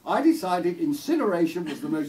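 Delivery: loud, high, posh English lady; Maggie Thatcher accent. Acted. Posh lady explaining her poodle's fate to a visitor, posh accent. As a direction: justify it grandly in an upper-class accent. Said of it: "posh sort of Maggie Thatcher accent".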